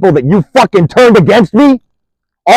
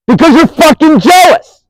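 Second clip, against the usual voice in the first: angry accusation; 8 semitones higher than usual. Real, not acted. Angrily accusing the woman who made deals. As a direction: pitch jumps high on jealous, spat out.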